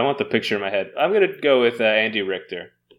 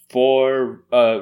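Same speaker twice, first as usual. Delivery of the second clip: normal talking voice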